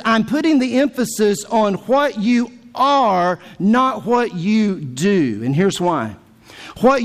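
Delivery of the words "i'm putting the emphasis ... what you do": slow, with "not" stretched and falling; preacher spelling out Jesus's emphasis, slowing to a crawl; slow emphatic quote. Acted. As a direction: drag "not what you do" out very slowly. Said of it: "Jesus says".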